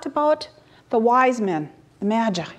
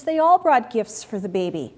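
ordinary delivery, nothing marked

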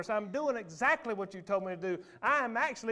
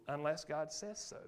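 sudden hush; 6 semitones lower, 8 dB quieter than usual. Acted. Drop after loud climax.

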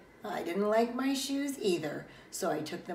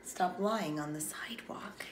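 whispering; 6 dB quieter than usual. Acted. Mother asking to keep voice down.

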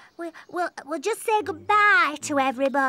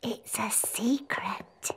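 softer, secretive whispered answer; 9 semitones lower, 8 dB quieter than usual. Acted. Hiding Sheepy from the Queen, soft whisper. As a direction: keep the hiding place secret in a hush.

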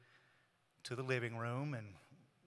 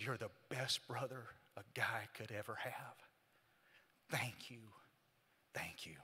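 hushed, softly retold thanks; tearful gratitude; 2 semitones lower, 7 dB quieter than usual. Dying brother thanks his brother in the car, tearful gratitude. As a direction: sink softly to a near whisper. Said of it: "with tears in his eyes".

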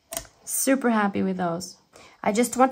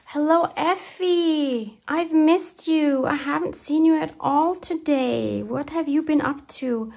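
fond greeting; 6 semitones higher than usual. Pretend. Greeting a dear friend missed all day, fondly.

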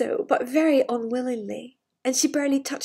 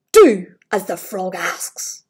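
stern command; 7 semitones lower, 6 dB louder, slower than usual. Acted. King orders princess.

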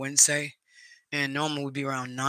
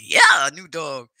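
retold excitement; 11 dB louder, slower than usual. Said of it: "I was like".

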